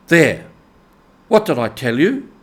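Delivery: loud, ringing gloat; triumphant. A narrator's reading. Rat crowing to the mole over the doormat, triumphant. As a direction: crow over being right. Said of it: "exclaimed the rat in great triumph".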